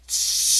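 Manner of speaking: hissed shush; sharp hiss. A narrator's reading. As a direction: hush him with a sharp hiss. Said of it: "hissed Professor McGonagall".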